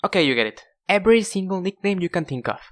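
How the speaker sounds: normal talking voice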